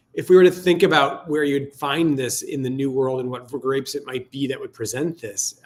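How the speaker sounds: ordinary talk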